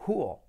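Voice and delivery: "cool" as KOO-ol; pronunciación correcta. Shown as a demonstration. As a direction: let "cool" fall into a dark l. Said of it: "Letra clave L". ("cool" dicho «cúol»)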